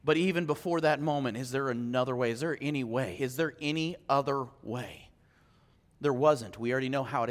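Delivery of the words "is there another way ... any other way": soft, low repeated plea; quiet pleading. Acted. Preacher voicing Jesus begging the Father, hushed. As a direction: ask it softly, almost to yourself.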